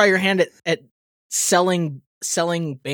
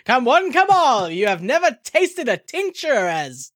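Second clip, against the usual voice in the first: carnival barker; 6 semitones higher than usual. Pretend. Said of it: "a snake oil salesman".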